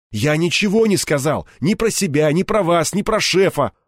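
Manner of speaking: high, insistent self-defence; heated protest. A narrator's reading. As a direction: protest your innocence, drawing out the first line. Кирпич горячо оправдывается перед бандой. (горячо оправдываясь, на повышенных тонах)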